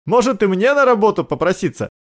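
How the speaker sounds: high-pitched, lightly wry question; wry amusement. A narrator's reading. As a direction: ask it as a half-joke, pitched high. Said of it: "усмехнулся Рон".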